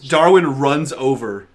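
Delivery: normal talking voice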